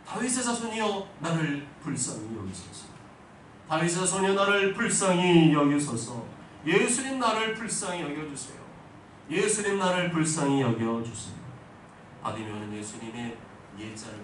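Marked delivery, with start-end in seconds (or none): 0.0-11.4 loud, high desperate cry for mercy. Acted. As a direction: call out to Jesus across the crowd. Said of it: "예수님 향해 외쳤어요".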